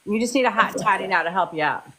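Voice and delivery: usual speaking voice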